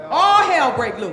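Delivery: laughing shouted saying. Shown as a demonstration. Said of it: "Don't say it with me".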